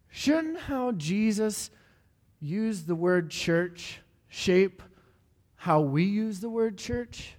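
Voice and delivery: slow and deliberate, each phrase weighed; slow rhetorical question. Acted. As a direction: let the question hang, slowly.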